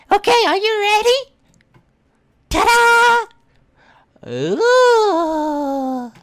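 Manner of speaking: put-on high, loud triumphant anime girl; proud girl voice. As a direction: show it off proudly.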